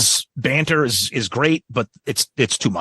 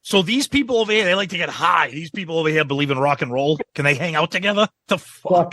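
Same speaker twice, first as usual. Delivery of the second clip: much higher mock frontman voice; mocking the frontman dividing the crowd; mock Paul; 3 semitones higher than usual. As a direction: parody his crowd-splitting banter. Mocking Paul's banter.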